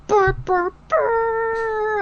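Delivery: high hammed-up monster burr; crab monster noise. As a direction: make a silly giant crab sound. Giant crab comes.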